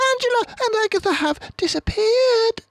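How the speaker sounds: high, loud and upset; distressed mousekeeper. A narrator's reading. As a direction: blurt out the bad news.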